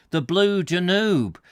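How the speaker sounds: ordinary delivery, nothing marked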